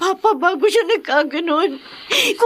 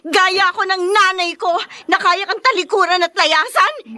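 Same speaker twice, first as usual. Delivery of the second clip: usual speaking voice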